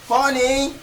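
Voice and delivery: high-pitched call to his wife. Acted. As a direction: call your wife from the next room. Calling wife from another room.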